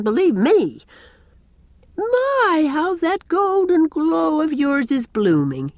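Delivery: high-pitched, emphatic neighbour; Miss Cornelia, emphatic approval. A narrator's reading.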